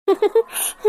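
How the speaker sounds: short scornful chuckle; mocking laugh. Accused schemer scoffing at the young woman, mocking laugh. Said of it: "எங்களுக்கு சிரிப்பே வருது".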